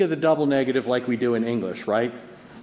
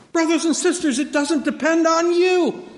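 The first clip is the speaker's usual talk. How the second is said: much higher and louder pleading; impassioned preaching; 6 dB louder than usual. Acted. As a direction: plead with the congregation. Impassioned appeal to congregation.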